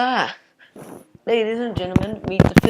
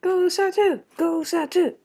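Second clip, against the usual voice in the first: cheering; 12 semitones higher, slower than usual. Acted. Cheering a competitor.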